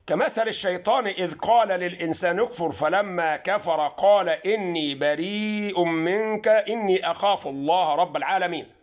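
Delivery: Quran recitation. Shown as a demonstration. Teacher recites Fussilat verse to viewers, slow chant. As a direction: chant the verse out, drawn long.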